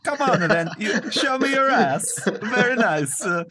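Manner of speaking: put-on very high, slow, loud comic foreign accent; Borat. Man leering at woman, high Borat voice. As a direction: leer in a squeaky, hammed-up foreign voice. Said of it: "why he's turning into Borat".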